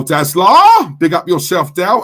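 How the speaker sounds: loud, drawn-out hail; big, booming greeting; rousing shout-out. Real, not acted. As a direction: holler a nickname to hype up a regular. Excited shout-out to a chat regular.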